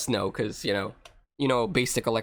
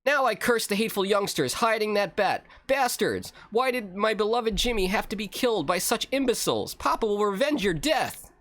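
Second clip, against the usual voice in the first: higher-pitched melodramatic curse; vengeful Papa; 7 semitones higher than usual. Acted. Father curses his son's killers, melodramatic. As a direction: ham up a grieving father's vow.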